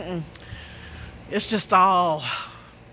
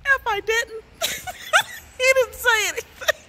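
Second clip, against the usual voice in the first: laughing; 11 semitones higher, 3 dB louder than usual. Real, not acted. Laughing helplessly at the memory.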